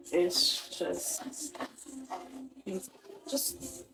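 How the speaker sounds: words fading out into a whisper; hushed and faltering. Real, not acted. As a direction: breath-only whisper trailing off. Voice failing in distress.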